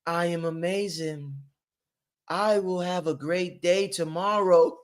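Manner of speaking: slow, soft self-affirmation; a hammed-up soft mantra; mock affirmation. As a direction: intone it like a serene mantra. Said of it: "you go".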